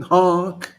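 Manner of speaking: single goofy honk; honking horn. Pretend. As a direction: toot a clown horn once. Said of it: "Anybody hear the horn?".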